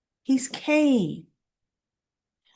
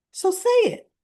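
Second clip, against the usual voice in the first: demanding; 12 semitones higher than usual. Retold or imitated. Lily refuses Amanda, demanding answer.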